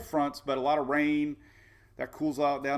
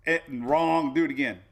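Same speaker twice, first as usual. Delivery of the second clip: louder, curt rejection voice; mock website buzzer voice; 6 dB louder than usual. The site rejecting his answer, a loud, curt mock buzzer. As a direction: snap it like a machine saying no.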